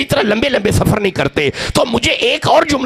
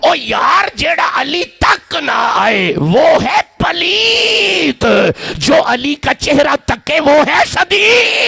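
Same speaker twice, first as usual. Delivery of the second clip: full-force preaching shout; 9 semitones higher, much slower than usual. Acted. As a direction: hurl the line at the crowd, drawn out. Peak of the sermon, shouting.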